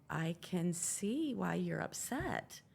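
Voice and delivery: slow, measured retold reply; weighing each word slowly; careful concession. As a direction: draw it out, picking the words carefully. Husband carefully answers upset wife.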